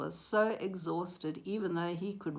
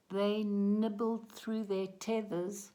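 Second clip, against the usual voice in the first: ordinary delivery, nothing marked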